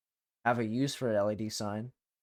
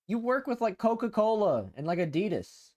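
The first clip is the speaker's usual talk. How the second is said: incredulous; 8 semitones higher than usual. Real, not acted. Streamer baffled why an ad targets her, incredulous. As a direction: pitch jumps much higher in disbelief. Mock-incredulous aside to chat.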